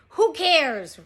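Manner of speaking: big storybook captain's bellow; roar. A narrator's reading. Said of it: "roared Captain Bones".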